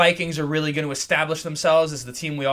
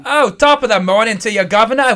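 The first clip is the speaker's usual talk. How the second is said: a mock British accent, a bit higher and louder; 5 semitones higher, 8 dB louder than usual. Host greeting the London game in a mock British accent. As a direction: toss off a jokey British greeting. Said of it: "is that a British accent?".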